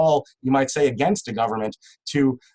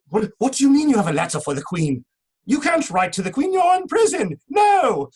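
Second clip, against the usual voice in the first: outraged guard; 10 semitones higher than usual. Retold or imitated. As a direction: play the guard, getting more and more scandalised until he shouts. Guard refuses the letter.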